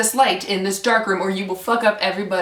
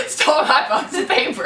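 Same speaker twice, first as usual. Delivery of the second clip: laughing; 6 semitones higher than usual. Real, not acted. Laughing at her own story.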